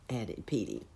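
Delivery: ordinary delivery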